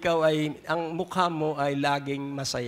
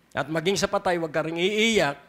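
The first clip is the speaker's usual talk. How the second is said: ordinary talk, nothing marked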